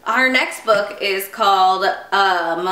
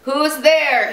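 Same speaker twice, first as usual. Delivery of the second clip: raised-pitch storybook boom; mild boom; 6 semitones higher than usual. A narrator's reading. Father Bear questions whoever knocked, mildly booming. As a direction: boom it out lightly. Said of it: "he boomed".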